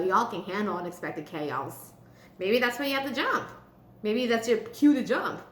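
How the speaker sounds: ordinary talk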